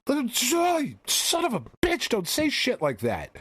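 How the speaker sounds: very high, incredulous yelling; retold hysterical indignation, voice shooting up; outraged outburst. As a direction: screech in disbelief at the doctor. Retold outraged reply to doctor.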